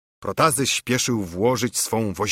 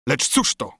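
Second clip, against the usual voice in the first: ordinary delivery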